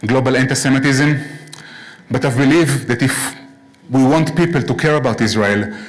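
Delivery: ordinary talk, nothing marked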